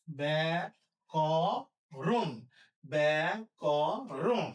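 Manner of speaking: ব্যা-ক-রণ drawn out in three separate parts; syllable-by-syllable pronunciation. Shown as a demonstration. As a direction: pause after each syllable of ব্যা-ক-রণ. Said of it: "কি হবে".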